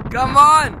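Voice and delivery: loud rising shout. Real, not acted. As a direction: yell it out to the guys. Calling out to friends.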